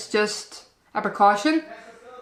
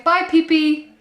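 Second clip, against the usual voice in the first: put-on high, louder little-girl bye; imitated toddler; 7 semitones higher, 4 dB louder than usual. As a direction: chirp it like a proud toddler. Mum voicing toddler waving pee away.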